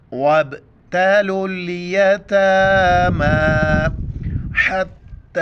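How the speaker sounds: melodic, drawn-out tilawat; Quran recitation. Acted. Teacher recites the verse line for students, melodic tilawat. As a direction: sing the verse slowly.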